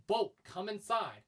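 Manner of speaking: calling the dog. Retold or imitated. Worker calling Bolt in from the rain, calling out. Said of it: "Like, I'll say".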